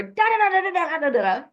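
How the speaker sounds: high, loud gibberish rant; mock rant. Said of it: "I can feel a rant coming on".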